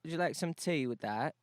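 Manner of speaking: playful British host imitation; British accent. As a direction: offer it in a put-on British accent. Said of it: "maybe a British accent".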